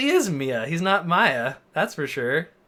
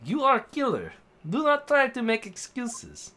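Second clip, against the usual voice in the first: higher-pitched put-on Russian accent; amateur Russian accent, raised pitch; 6 semitones higher than usual. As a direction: accuse firmly in Russian-accented English. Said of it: "Oh, he's Russian".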